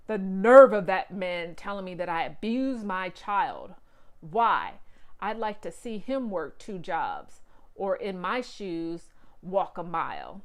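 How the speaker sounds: loud furious outburst; a fuming father venting about the other man; angry rant. A narrator's reading. Angry dad ranting in car.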